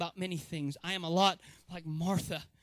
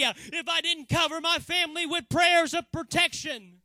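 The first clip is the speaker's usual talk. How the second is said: loud, higher, impassioned sermon; fervent preaching; 4 semitones higher, 6 dB louder than usual. Acted. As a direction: preach with full conviction. Preaching at full force.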